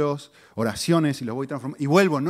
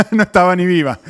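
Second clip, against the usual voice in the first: laughing; 5 semitones higher, 11 dB louder than usual. Real, not acted. Joking that Gaby wasn't born yet.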